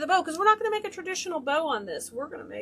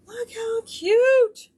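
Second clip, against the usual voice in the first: high, loud, slow drawn-out coo; delighted coo; 11 semitones higher, 8 dB louder, much slower than usual. Real, not acted. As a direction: swoon over the curl. Admiring the finished curl.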